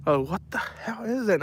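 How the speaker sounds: startled by a hissing possum; startled cry. Retold or imitated. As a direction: jump at the noise and ask what it is. Reacting to hissing animal.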